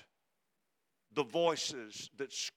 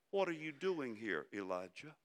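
softer, dropping lower and quieter at Elijah; gentle voice; 3 semitones lower, 7 dB quieter than usual. Acted. God gently questioning Elijah at the cave, soft and low. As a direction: ask it tenderly, almost under the breath. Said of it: "gentle whisper, that still small voice".

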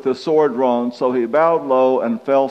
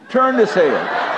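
higher, slow put-on rabbit voice with a laugh; rabbit's voice; 6 semitones higher, slower than usual. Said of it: "and he said".

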